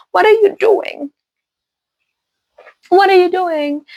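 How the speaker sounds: very high, incredulous questioning; exasperated high-pitched demand; indignant disbelief. Real, not acted. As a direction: scold them in a high, baffled voice. Indignant at vampires praying.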